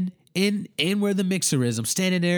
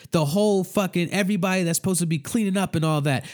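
usual speaking voice

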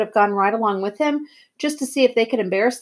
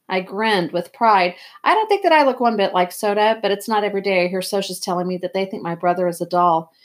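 usual speaking voice

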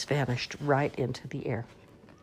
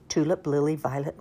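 ordinary delivery

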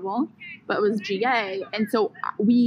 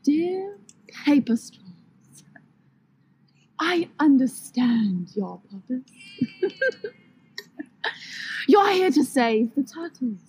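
a hint of a pompous complaint letter; mock letter; 6 semitones higher than usual. Friend performs a mock-outraged letter to paper straws, lightly voiced. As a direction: read it like a grand, aggrieved letter. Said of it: "You could do it".

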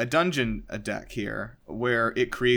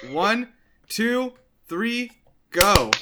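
impatient countdown, pitched up and loud; exasperated loud count; 10 semitones higher, 6 dB louder, much slower than usual. Real, not acted. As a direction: count it again louder and higher, exasperated. Repeats countdown after confusion.

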